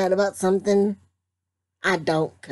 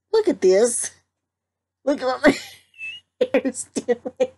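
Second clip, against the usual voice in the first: lightly voiced higher, exclaiming about hair; a touch of a fussing woman, pitched up; mock outrage; 7 semitones higher than usual. Imitating mom fussing about her hair, mock upset. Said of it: "why we said".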